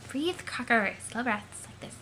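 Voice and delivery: soft, soothing coaching; hushed and steadying; calming friend. Acted. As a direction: talk them down gently. Second character calming the first.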